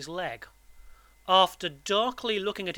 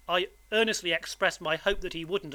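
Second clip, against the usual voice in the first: usual speaking voice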